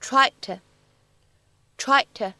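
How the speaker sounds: "tried" with the d dropped before to; loss of plosion. Shown as a demonstration. Teacher models the lost plosion in "tried to". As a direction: let the d of "tried" vanish. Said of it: "我们呢，会读成是tried to".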